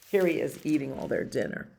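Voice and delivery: usual speaking voice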